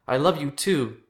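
high-pitched, heated declaration; strained, impassioned reply; emotional outcry. A narrator's reading. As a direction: cry it out mid-fight. Brothers yell love while fighting.

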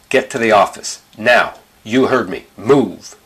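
loud, forceful teacher's command; stern order. A narrator's reading. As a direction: send the pupil out, brooking no argument. Teacher orders pupil out of class.

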